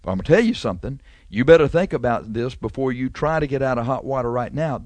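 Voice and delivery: usual speaking voice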